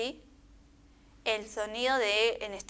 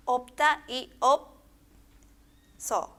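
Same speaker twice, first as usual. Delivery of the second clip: "opta y opso" read very slowly, each word set apart; slow model reading; 3 semitones higher, 4 dB louder, much slower than usual. Shown as a demonstration. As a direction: spell out opta y opso for learners. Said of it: "Ahí tenemos".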